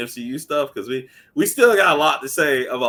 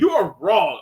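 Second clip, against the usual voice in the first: a louder, higher "you are wrong"; imitating Cole Jackson; 7 semitones higher, 6 dB louder than usual. Cole Jackson telling the host he's wrong, mock indignant. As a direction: lightly voice a friend telling him off. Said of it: "He's just like".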